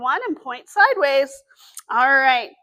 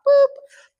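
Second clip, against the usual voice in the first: playful boop; 12 semitones higher, 8 dB louder than usual. Acted. High five with puppet.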